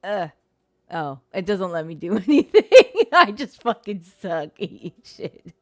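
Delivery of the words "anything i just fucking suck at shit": loud laughing groan at own failure; laughing frustration. Real, not acted. Player laughing at her own failure, loud and laughing.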